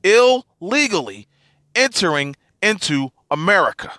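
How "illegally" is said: "illegally" broken into "il" and "legally", first part punched; emphasis on illegally. Real, not acted. Podcaster repeating his point once again, emphasizing "illegally". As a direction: hammer "illegally" in two deliberate pieces. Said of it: "emphasizing the word illegally".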